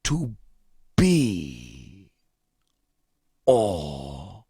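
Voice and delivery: low, drawn-out theatrical start; grand Shakespearean pause. Shown as a demonstration. Innkeeper demonstrates Hamlet to the young actor, grandly. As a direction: savour each word. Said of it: "Allow me to demonstrate".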